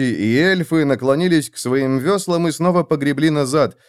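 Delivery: usual speaking voice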